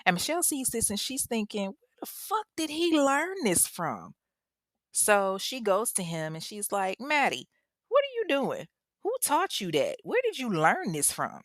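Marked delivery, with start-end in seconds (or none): 1.7-4.2 high-pitched disbelieving question; mock incredulity. Mother thinking to herself about her son's toys, incredulous. 7.0-11.4 put-on high, alarmed interrogation of a child; questioning mother. Mother questioning her young son about his toys, alarmed. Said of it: "She's rattling off questions to him".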